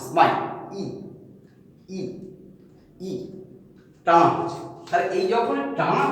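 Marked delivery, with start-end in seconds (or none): 0.8-3.1 ই said with lips spread in a smile; ঠোঁট টেনে হাসির মতো ই; spread-lip demo. Shown as a demonstration. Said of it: "দুটো এরকম টানা হচ্ছে". (প্রসারিত ঠোঁটে ই)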